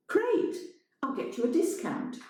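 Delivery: high mock-cheerful; bright fake enthusiasm; sarcastic. A narrator's reading. Elena sarcastic.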